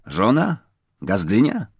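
ordinary delivery

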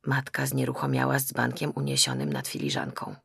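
ordinary delivery, nothing marked

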